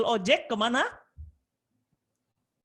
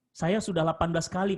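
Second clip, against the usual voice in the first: ordinary delivery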